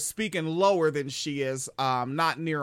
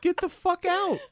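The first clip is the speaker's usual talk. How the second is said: higher put-on yell of the worker; mock worker yell. Quoting the pizza worker to the cohost, a high mock yell. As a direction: bark it like the fed-up worker. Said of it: "He's just saying".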